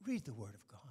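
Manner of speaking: whispered urging to read scripture; dramatic whisper. Shown as a demonstration. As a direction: breathe it like a secret. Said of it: "I would like to dramatically say".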